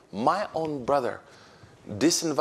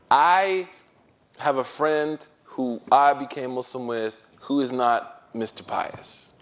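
ordinary talk

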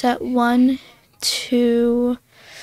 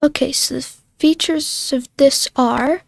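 ordinary talk

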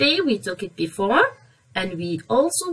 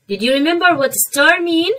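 normal talking voice

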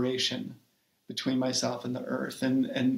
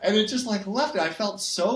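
laughing; 8 semitones higher, 3 dB louder than usual. Real, not acted. Laughing along at Harry Potter joke.

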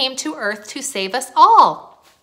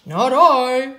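louder dog character voice; storybook dog; 5 semitones higher, 4 dB louder than usual. A narrator's reading. Dog refuses to help plant, loud character voice. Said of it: "said the dog".

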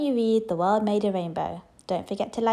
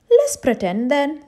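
loud, higher cheerful maid; 6 semitones higher, 8 dB louder than usual. A narrator's reading. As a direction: suggest a game brightly. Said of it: "said the maid".

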